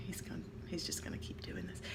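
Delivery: hushed aside whisper. Retold or imitated. Mother muttering to herself about son, whispered. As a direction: whisper it to yourself, resigned.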